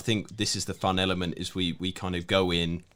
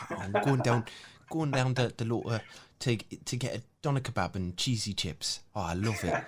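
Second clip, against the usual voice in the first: put-on Geordie accent; 3 semitones higher than usual. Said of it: "copy your, your native tongue".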